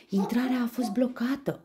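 put-on high, loud startled old man; gasping grandpa. A narrator's reading. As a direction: gasp it out as grandpa. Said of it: "icnește bunicul". (bunic care icnește)

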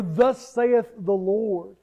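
"Thus saith the Lord" drawn out very slowly, each word weighted; slow solemn formula. Retold or imitated. Preacher quoting a rabbi: slow, solemn "Thus saith the Lord". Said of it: "might have used a phrase like".

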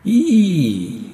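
long, loud, high "hi" cry; high held wail. A narrator's reading. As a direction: send out a piercing, drawn-out "eee". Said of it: "dit une voix". (long cri aigu)

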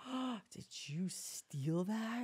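gasp, then draw it out in disbelief; mock shock. Mom discovering her toddler stole something, slow scandalized gasp. Mother's acted shock at theft.

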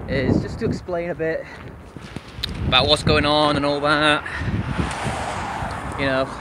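ordinary talk, nothing marked

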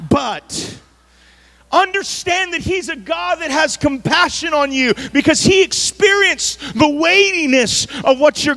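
full-force preaching. Acted. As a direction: lift the church with it, full voice.

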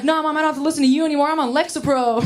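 put-on fast, bratty teen defiance; defiant. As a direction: snap back like a rebellious teenager. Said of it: "I'm like".

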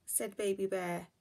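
ordinary delivery, nothing marked

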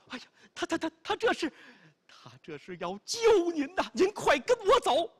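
high, loud breathless urging; urgent stammer. Acted. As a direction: stammer it out in a rush. Qinzi urgently explaining the sacrifice.

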